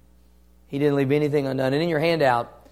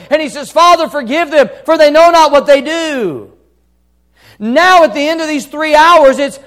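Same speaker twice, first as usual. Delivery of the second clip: loud, high-pitched sermon delivery; impassioned preacher at peak volume; full-force preaching; 11 semitones higher, 11 dB louder than usual. Real, not acted. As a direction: drive it home to the congregation at full voice.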